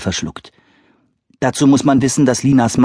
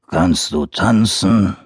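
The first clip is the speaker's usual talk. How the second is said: growling bear; much slower than usual. Acted. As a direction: rumble it like a big bear. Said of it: "brummte der Bär".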